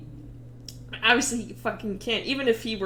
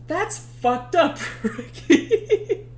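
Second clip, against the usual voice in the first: higher, slow line cracking into laughter; laughing reproach; 7 semitones higher, much slower than usual. Acted. Ulysses reproaching Ricky, teller laughing through it. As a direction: scold while failing not to laugh.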